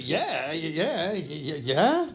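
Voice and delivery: tongue-tied gushing yeahs, rising; starstruck babbling. Retold or imitated. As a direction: stammer like a starstruck fan. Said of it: "And I was like".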